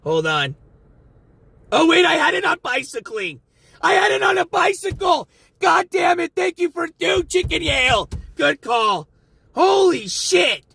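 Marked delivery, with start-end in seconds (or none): 1.7-10.6 real yelling. Real, not acted. Driver shouting to his passenger after finding the bike-mode mistake. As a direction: yell it out, half laughing at himself. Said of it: "Sorry I'm yelling".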